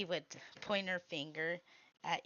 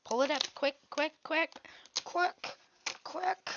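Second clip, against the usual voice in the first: rising urgent repeats, higher and higher; 8 semitones higher than usual. Real, not acted. Pleading with the stuck thing to come up quick. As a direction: hurry it along, pitch climbing.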